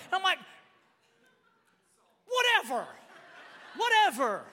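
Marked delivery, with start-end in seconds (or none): 2.3-4.5 high, loud brush-off; mock dismissive. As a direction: play the sore loser waving it away. Mock defiant comeback to counselor.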